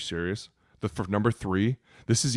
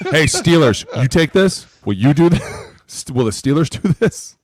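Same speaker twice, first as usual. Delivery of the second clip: mocking call-out; 4 semitones higher, 4 dB louder than usual. Mocking calls to another team.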